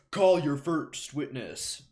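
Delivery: slow, loud made-up judge voice; Judge Bumbleton. Acted. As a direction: draw out the order from the bench. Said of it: "the Judge Bumbleton voice I've made".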